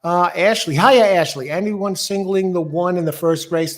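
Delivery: ordinary talk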